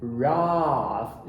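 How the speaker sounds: long British ah in the syllable raf; low, drawn-out British raaf; British accent. Shown as a demonstration. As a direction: open back A, the British way. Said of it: "No inglês britânico, eles vão falar com a".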